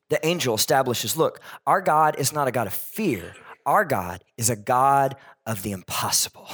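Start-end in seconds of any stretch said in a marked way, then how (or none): none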